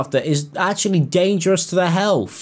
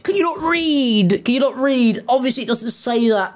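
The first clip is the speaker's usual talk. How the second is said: exasperated; 7 semitones higher than usual. Real, not acted. Exasperated at the misreading.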